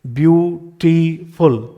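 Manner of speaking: ब्यूटीफुल very slow, byoo-ti-ful split apart; ब्यूटीफुल drawn out syllable by syllable, falling at the end; syllables spelled out. Shown as a demonstration. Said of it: "तीन syllables आपको यहाँ पे दिखाई पड़ रहे हैं".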